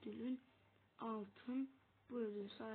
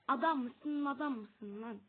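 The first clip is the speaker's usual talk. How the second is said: 1 angry scolding; 8 dB louder than usual. Real, not acted. Scolding game character angrily.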